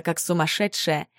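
plain reading voice

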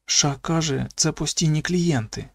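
hushed bedtime whisper, still lightly voiced; whispering child. A narrator's reading. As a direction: confide it in a hushed voice. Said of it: "прошепотів Ейвері".

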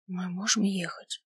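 half-whisper. Acted. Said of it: "спрашиваю полушёпотом".

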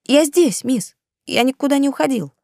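put-on high, calm young boy; calm boy servant. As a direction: answer calmly as the boy. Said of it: "спокойно ответил мальчик".

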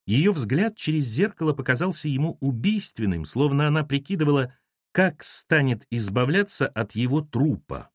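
ordinary delivery

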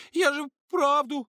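high, loud, slow forced-out cry; frightened blurt. A narrator's reading. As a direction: squeeze it out, stammering. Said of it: "заикнулась и выдавила". (Маша испуганно выдавливает)

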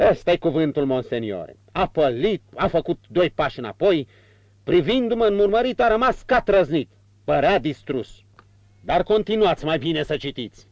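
Murphy gleefully describing the villain's collapse; excited old servant. Acted. Murphy tells his master how the villain paled, high eager retelling.

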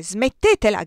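a loud, sharp shout to stop. A narrator's reading. Colonel shouts at the crying girl, loud. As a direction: shout her down. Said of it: "gridò".

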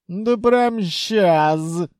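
slow, drawn-out indignant retort; indignant treasury. A narrator's reading. Treasury rebuffs the coaxing djinn, indignant. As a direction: scoff it out slowly. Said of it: "возмутилась казна".

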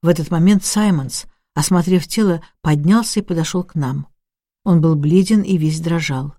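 ordinary delivery

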